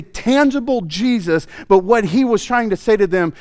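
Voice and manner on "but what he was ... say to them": normal talking voice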